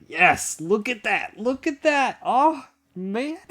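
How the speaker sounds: high-pitched, giddy admiration; thrilled at the beauty of it; real delight. Real, not acted. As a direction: climb high in gleeful awe. Admires the perfect picture.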